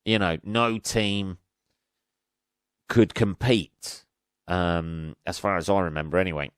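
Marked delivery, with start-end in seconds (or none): none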